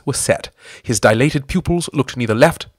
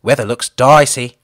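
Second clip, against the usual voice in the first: dry; 6 dB louder than usual. A narrator's reading. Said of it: "observed Ginger dryly".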